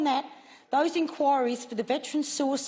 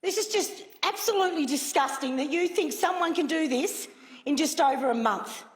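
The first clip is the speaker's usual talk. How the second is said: usual speaking voice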